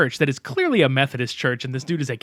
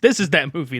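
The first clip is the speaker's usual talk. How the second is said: ordinary talk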